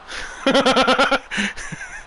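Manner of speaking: high chuckling laugh. Real, not acted. Commentator laughing at his own accent joke, chuckling.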